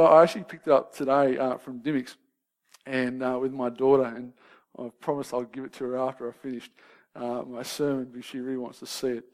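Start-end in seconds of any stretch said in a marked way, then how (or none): none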